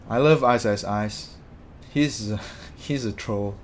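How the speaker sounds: ordinary talk, nothing marked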